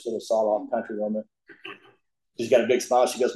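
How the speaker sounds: ordinary talk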